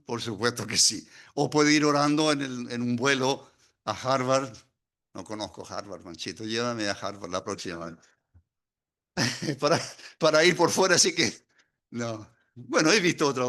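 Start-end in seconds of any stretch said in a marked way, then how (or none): 5.1-8.0 quiet aside. Real, not acted.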